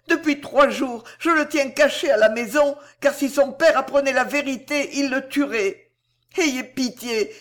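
mother begging the headmaster, weeping; tearful plea. A narrator's reading. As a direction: beg the headmaster through tears. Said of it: "pleura-t-elle".

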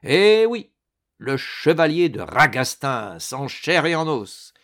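high, loud opening, then lively and emphatic; jaunty confirmation. A narrator's reading. Ragastens confirms to the stunned baron he lives, jaunty. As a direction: announce yourself with gleeful assurance.

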